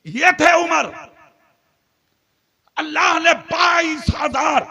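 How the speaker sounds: loud, impassioned sermon delivery; fiery preaching. Acted. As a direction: preach it to the whole gathering. Preacher at full force.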